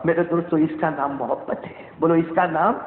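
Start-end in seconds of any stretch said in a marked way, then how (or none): none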